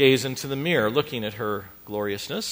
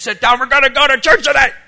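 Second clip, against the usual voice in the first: very loud, high-pitched angry parent, acted out; parental yell; 12 semitones higher, 17 dB louder than usual. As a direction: holler at the kids to sit down. Parents yelling at kids in minivan.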